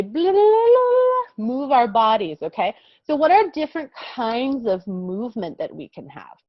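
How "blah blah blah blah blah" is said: playful high blah. Shown as a demonstration. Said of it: "So movement is when we".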